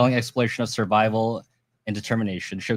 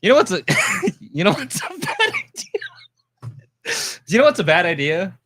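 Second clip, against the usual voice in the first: corny dad joke, cracking up; can't get the joke out for laughing; laughing through joke; 8 semitones higher, 6 dB louder than usual. Real, not acted. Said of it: "you can't even get the joke out".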